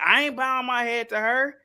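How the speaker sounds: mock indignation, refusing to show respect; lightly acted haughty dismissal; defiant refusal. Acting Meghan refusing to bow.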